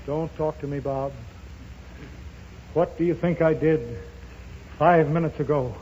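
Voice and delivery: ordinary delivery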